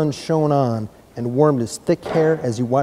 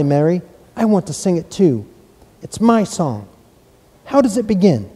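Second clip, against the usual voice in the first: put-on eager boy; 6 semitones higher, 3 dB louder than usual. A narrator's reading. Eager boy voiced in story.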